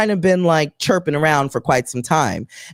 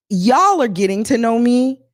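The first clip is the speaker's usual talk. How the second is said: ordinary talk, nothing marked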